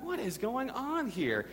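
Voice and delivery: high, slow mock bewilderment; baffled exasperation. Dad as his daughter starts crying, retold exasperation. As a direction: draw it out like a baffled parent.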